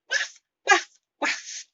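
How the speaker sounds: very high, loud barking waf; dog barks. Shown as a demonstration. Teacher barking like a dog for students. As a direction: bark like a small dog.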